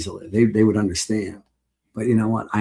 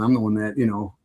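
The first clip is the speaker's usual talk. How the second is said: usual speaking voice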